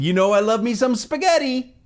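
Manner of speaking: high-pitched fake phone exclamation; mock phone yelling. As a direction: call it out for eavesdroppers. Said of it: "the same volume level that he is".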